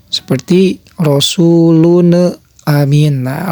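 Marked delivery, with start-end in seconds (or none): 1.0-3.3 slow "rasulun amin" with the n rebounding into an extra "ne"; wrong demo, echoed n in "rasulun amin"; bounced n. Shown as a demonstration. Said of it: "jangan ada pantulan".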